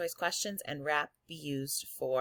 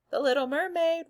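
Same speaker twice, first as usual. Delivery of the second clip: excited; 11 semitones higher, 8 dB louder than usual. Real, not acted. Teacher reading list, gushes title.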